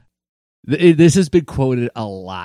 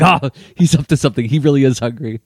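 higher, excited pretend alarm; mock kid's realization; 4 semitones higher, 5 dB louder than usual. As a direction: react like a gullible young reader. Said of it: "And they're like".

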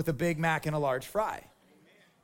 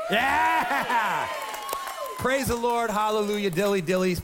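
excited cheer; 4 semitones higher, slower than usual. Acted. Pastor cheers his own line.

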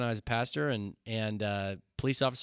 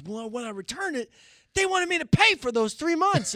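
high bragging inmate; 13 semitones higher than usual. Retold or imitated. The inmate boasts about the car, in a high voice. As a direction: brag like the car borrower. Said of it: "He goes".

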